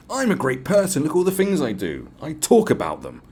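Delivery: lightly voiced smug bragger; mocking smug activist. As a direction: lampoon a boastful online activist.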